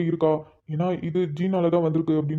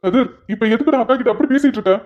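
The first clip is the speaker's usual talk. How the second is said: higher, louder reproach; scolding rebuke; 5 semitones higher, 5 dB louder than usual. Acted. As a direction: stop him cold, sharply. Rebukes Kathir for scolding sister.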